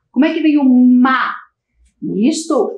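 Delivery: normal talking voice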